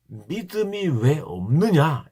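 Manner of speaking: very slow, drawn-out rebuke. Acted. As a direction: stretch each word of the question. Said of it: "이렇게 말합니다". (천천히 늘여 꾸짖듯이)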